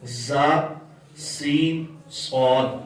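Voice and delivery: "زا، سین، صاد" with an audible sibilant whistle; whistling sound. Shown as a demonstration. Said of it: "سیٹی کی آواز نکلتی ہے".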